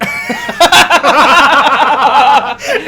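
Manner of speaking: loud rising burst of laughter; loud laughing fit. Real, not acted.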